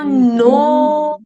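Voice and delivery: नो held long, firm and sustained; long chanted नो; modelled syllable. Shown as a demonstration. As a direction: stretch out the o of नो for the class. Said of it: "उसके बाद क्या कहना है".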